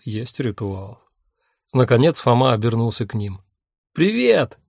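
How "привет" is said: bright excited hello. Acted. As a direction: greet him eagerly. Cheerful greeting to Fома.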